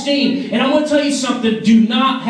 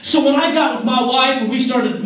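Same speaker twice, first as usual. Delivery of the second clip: ordinary talk, nothing marked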